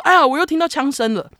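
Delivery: caller's startled cry, high and loud; retold alarm.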